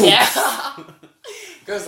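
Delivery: high squeal of laughter, loud; laughing at the tiny audience. Real, not acted. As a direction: burst into a high shrieking laugh. Laughing at dropping listener figures.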